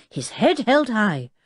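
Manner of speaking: ordinary delivery, nothing marked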